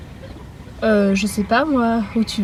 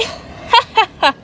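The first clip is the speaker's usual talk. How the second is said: high witchy cackle; short shrieking cackle; evil cackle; 10 semitones higher than usual. Acted. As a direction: cackle with delight. Second villain cackles.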